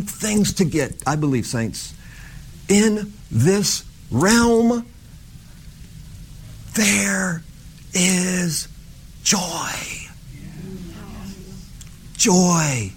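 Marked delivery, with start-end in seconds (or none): 2.7-12.9 slow hushed emphasis. Acted. Preacher dwells slowly on joy.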